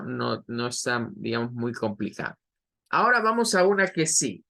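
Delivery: normal talking voice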